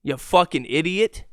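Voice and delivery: ordinary delivery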